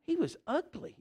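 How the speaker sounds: high mocking jab. Preacher mocking a daughter's ex, high and teasing. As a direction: squeak it out in mockery.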